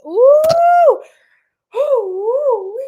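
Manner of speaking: high, loud "ooh" squeals; excited whoop. Real, not acted. Tarot reader reacting to Cancer's cards, excited high whoops. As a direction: let out high-pitched whooping oohs.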